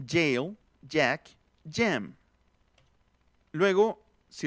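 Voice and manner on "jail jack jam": teacher drilling jail, jack, jam; English j. Shown as a demonstration. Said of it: "Otra vez".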